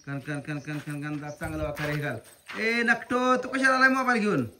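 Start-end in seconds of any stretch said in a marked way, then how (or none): 2.6-4.5 raised, high-pitched telling-off; scolding. Real, not acted. Parent questioning child about bringing the phone, scolding.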